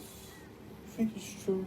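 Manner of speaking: breathy unvoiced whisper of the question; hushed whispered doubt. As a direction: whisper it like a secret. Whispered question, then repeated.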